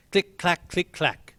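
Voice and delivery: high, crisp clicking noises; imitated bead clicks; abacus clicks. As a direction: tap out the abacus beads.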